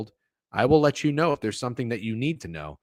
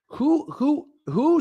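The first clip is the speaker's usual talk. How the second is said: excited who; 4 dB louder, much slower than usual. Real, not acted. Gloating over the slump talk.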